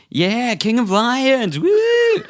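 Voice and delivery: high, drawn-out whooping fan; crowd cheer. Acted. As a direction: cheer wildly from the crowd, building to a woo. Voices someone cheering from the crowd.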